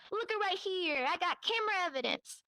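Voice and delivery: high, insistent mock confrontation; emphatic evidence reveal. Retelling a man slamming papers down, mock insistence. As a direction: present it like slammed-down proof.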